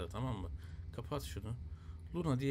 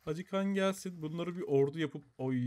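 usual speaking voice

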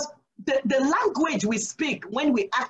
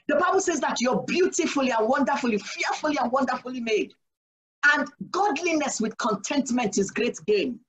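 usual speaking voice

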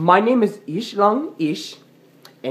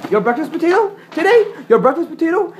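much higher, louder indignant complaint; shrill outraged cry; 10 semitones higher, 5 dB louder than usual. Pretend. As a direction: cry out the grievance high and loud. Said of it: "I'm very mad right now".

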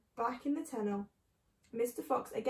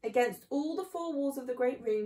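usual speaking voice

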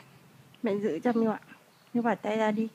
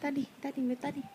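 nói khẽ; 2 semitones higher, 8 dB quieter, faster than usual. Real, not acted.

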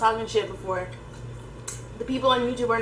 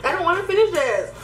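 whiny plea; 6 semitones higher, faster than usual. Real, not acted. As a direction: beg not to keep eating. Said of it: "begging not to finish".

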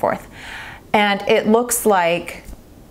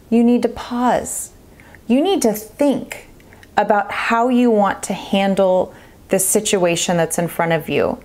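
ordinary talk, nothing marked

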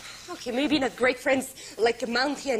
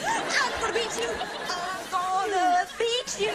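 taunting; 5 semitones higher than usual. Acted. Taunting rival in election.

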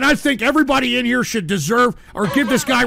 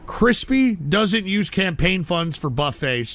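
usual speaking voice

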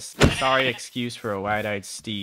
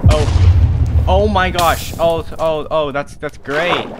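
loud startled gasp, then slow; 4 semitones higher, 8 dB louder, much slower than usual. Real, not acted. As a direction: caught off guard, loud. Startled by explosion.

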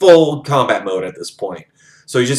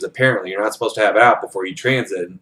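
normal talking voice

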